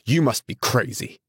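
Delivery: mildly incredulous outburst; automatic exclamation. A narrator's reading. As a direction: exclaim it on reflex. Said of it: "exclaimed Tom automatically".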